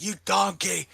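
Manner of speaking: high, loud mock yell; Gordon Ramsay. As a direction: imitate a TV chef bellowing an insult. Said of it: "yell at people".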